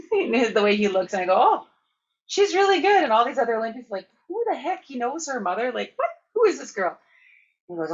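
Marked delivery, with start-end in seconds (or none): 1.4-3.0 much higher and louder, gushing; bright eager reply. Retold or imitated. Answering the Prime Minister about her mother, high and loud. 4.3-7.0 higher, incredulous quick questions; mock the stunned crowd; baffled onlookers. Olympians puzzled that the PM knows her mother, high and incredulous.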